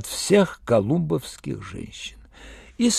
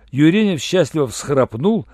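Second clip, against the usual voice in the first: plain reading voice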